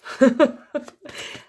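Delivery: high, short amused laugh. Real, not acted. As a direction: let out a high little laugh. Rit après une carte ratée. (petit rire aigu)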